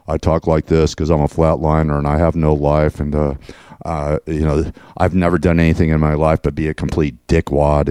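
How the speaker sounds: mocking impression. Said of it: "Mister Blabbermouth, the building inspector".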